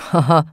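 brief gruff laugh; a quick amused ha-ha; short laugh. Acted. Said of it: "lachte der Nikolaus".

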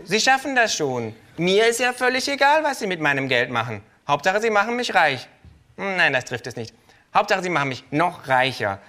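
high, loud, cheeky kangaroo voice; brash kangaroo. Acted. Kangaroo voice again.

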